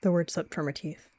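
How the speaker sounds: ordinary delivery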